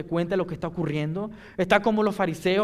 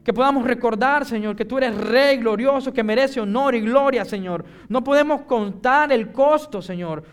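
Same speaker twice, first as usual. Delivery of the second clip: raised, louder voice with driving emphasis; impassioned plea to the Lord; fervent preaching prayer; 6 semitones higher, 6 dB louder than usual. Real, not acted. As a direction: pray it out with preacher's fervour.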